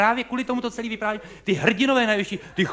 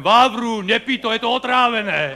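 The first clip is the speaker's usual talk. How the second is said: warning shouted in Moravian dialect, Vávru and otrávené with Moravian endings; 4 semitones higher, 4 dB louder than usual. Shown as a demonstration. Spectator warns the actor Vávra in Moravian dialect. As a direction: call out to him in a Moravian dialect, louder. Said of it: "tak dialektem".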